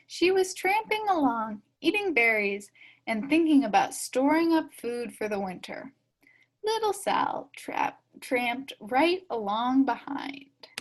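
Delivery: usual speaking voice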